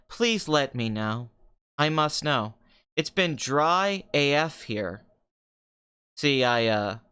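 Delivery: ordinary talk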